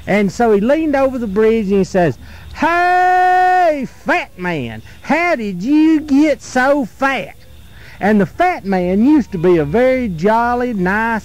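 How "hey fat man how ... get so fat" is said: sing-song teasing call, slow, jumping high on hey; slow mocking schoolboy jeer; boy's teasing taunt. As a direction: taunt him like a cheeky little boy. Boy taunts the troll-like fat man.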